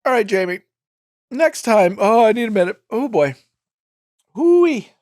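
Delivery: strained voice, high after coughing; catching breath after wine in lung. Real, not acted. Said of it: "Wine lung is terrible".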